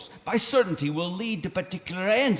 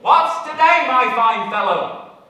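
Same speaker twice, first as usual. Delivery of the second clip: loud, slow call down to the street; 5 semitones higher, 7 dB louder, slower than usual. Acted. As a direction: hail the boy below. Calls to boy in street.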